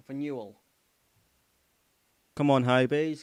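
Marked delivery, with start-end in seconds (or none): 2.4-3.2 louder rallying shout; loud cheer. Real, not acted. Fan urging Hibs on at a corner, loud cheer. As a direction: roar the team on.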